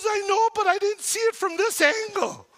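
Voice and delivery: a hint of the eager mother; imitating mom. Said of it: "She goes".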